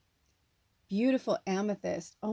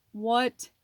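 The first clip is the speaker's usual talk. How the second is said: normal talking voice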